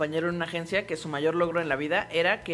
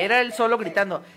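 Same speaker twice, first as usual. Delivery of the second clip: ordinary talk, nothing marked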